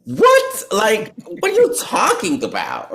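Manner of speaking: what?! rising in high disbelief; mock outraged rant at the instructors; exasperated disbelief. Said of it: "part of that mad piece".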